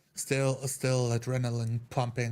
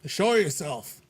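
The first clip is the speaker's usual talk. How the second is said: loud call, pitched up; yelling; 4 semitones higher, 5 dB louder, slower than usual. Acted. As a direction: shout it out as a challenge. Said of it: "I yell out".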